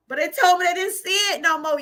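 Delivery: telling her audience the good news with a joyful shout. Real, not acted. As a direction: shout it out like testimony. Testifying, overjoyed.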